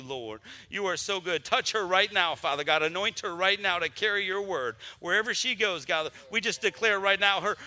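pastor's rising, pleading prayer with a steady push on each line; fervent prayer. Real, not acted. Pastor praying over the departing Navy recruit and her family, fervent prayer. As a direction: pray it out over her with conviction, calling on Father God.

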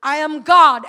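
slow, solemn divine voice rising loud; a hint of a solemn divine voice; voice of Jesus. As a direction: announce it with weight. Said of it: "but I heard a voice".

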